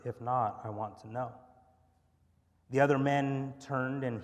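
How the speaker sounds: steady, earnest scripture reading voice. Acted. Pastor reading Genesis to the congregation, earnest. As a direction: read the passage with weight.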